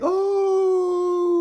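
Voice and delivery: huge high loud gasp of amazement; class exclaiming. Retold or imitated. Classmates reacting to the bonus marks, loud excited shout. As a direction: shout it like the whole class is stunned. Everyone's like.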